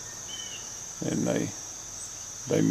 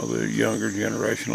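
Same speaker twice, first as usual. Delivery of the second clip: ordinary delivery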